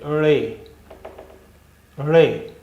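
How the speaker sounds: रे said slowly and clearly as a letter name. Shown as a demonstration. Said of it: "इस अक्षर का नाम है रे".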